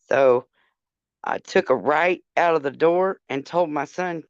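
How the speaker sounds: ordinary delivery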